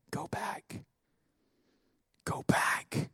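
hushed, soft whisper; breath-only whisper, unhurried; whispered divine command. Acted. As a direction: whisper it like God's still voice. Voicing the Lord to Elijah.

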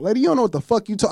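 fast, high, heated cursing; rapid-fire chewing out the clerk; cussing her out. Retold or imitated. Cussing out the lady, fast and heated. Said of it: "I'm cussing the lady out".

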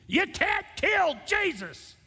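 a man shouting back at a TV preacher's book title, furious; screaming at the TV. Retold or imitated. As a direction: yell it at the television in outrage. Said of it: "I remember screaming at the T V".

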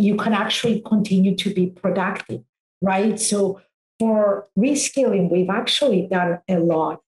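ordinary delivery

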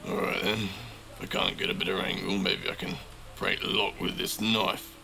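lightly voiced inner monologue; thinking to himself. A narrator's reading. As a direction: talk yourself through a plan. Narrator voices Yuri's thoughts.

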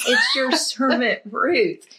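high, slow, meek servant voice; quietly humble Ruth. Acted. Ruth answering her master humbly, high meek acted voice. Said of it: "she just quietly says".

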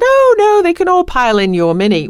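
high, loud dismissal of any objection; emphatic Irving quote. Retold or imitated. Friend waving away talk of a car service, high and emphatic. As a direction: swoop up high on a breezy protest. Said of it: "And Irving said".

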